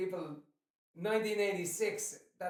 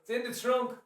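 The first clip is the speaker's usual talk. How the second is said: higher, louder mock American twang; 5 semitones higher, 4 dB louder than usual. Irish dad echoing what everyone says here, mock American twang. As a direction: put on an American twang, pitched up. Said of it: "some of the American twang".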